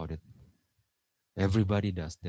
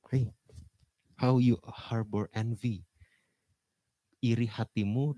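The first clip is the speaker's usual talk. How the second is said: ordinary delivery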